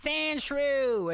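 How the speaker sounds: high-pitched Southern drawl impression; shrill Southern accent. Imitating the whip trainer from Pokemon, shrill Southern accent. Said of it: "really kind of shrill Southern accent".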